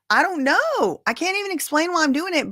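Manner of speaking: lightly voiced bewildered woman, high and fast; a hint of a confused young woman. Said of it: "She's like".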